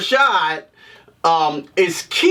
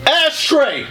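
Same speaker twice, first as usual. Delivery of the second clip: higher-pitched call for an ashtray; mock yell; 6 semitones higher than usual. Smoker in bed with no ashtray, mock yelling for one. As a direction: holler it out like a movie catchphrase. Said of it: "I'm just gonna yell that shit out".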